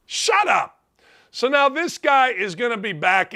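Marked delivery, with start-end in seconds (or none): 0.1-0.8 sharp shut up. Real, not acted. Rising rebuke.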